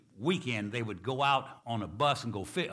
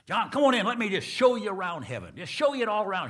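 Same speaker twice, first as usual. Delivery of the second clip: higher, louder, beaming welcome; joyful welcome; 9 semitones higher, 7 dB louder than usual. Acted. Saint Peter welcoming John into heaven, joyful and loud. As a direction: throw the gates open for him.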